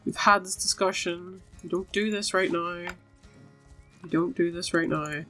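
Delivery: ordinary delivery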